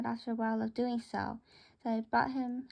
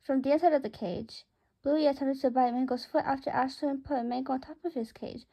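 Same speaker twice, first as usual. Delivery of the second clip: usual speaking voice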